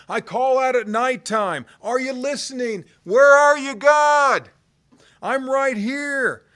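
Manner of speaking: builds to a high, loud desperate cry; crying out to God. Acted. Voicing the psalmist crying to God.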